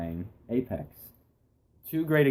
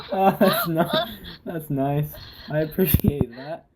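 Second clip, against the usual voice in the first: laughing; 8 semitones higher, 3 dB louder, slower than usual. Real, not acted. Laughing after a rain remark.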